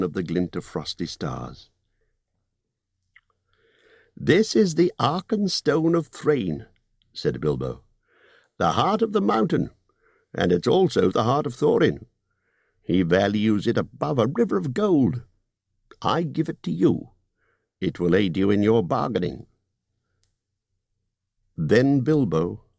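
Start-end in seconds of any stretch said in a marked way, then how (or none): 4.2-6.7 高めの声でゆっくり重々しく; 宝石を差し出すように厳かに; Bilbo, slow and solemn. Acted. Bilbo voiced in story. 8.6-19.5 高めの声で念を押すホビット; 利益の取り分を強調する商談口調; Bilbo's voice. Acted.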